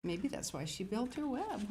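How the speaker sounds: usual speaking voice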